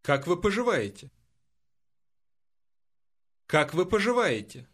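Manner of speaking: usual speaking voice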